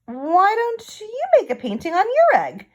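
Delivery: high, loud inviting question; friendly painter. Acted. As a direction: lift the whole line up brightly. Hans suggests to Hoppy.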